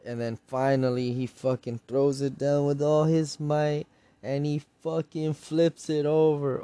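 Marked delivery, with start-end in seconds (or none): none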